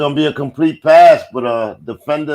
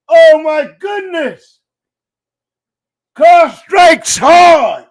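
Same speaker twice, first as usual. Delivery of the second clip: much higher and louder, yelling the big play; hyped shouting play-by-play; 10 semitones higher, 4 dB louder than usual. Real, not acted. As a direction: scream it like the bomb just landed. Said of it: "had to give you that energy".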